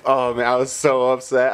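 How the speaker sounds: normal talking voice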